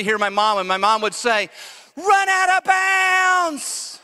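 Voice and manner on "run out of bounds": high, very slow called-out warning; mom calling out. Retold or imitated. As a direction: stretch it out like a worried mother yelling.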